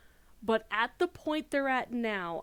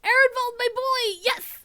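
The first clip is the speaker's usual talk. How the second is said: excited squeal; 13 dB louder than usual. Real, not acted. Fan squeals over favourite character.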